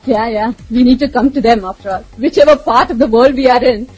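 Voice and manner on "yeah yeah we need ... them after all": normal talking voice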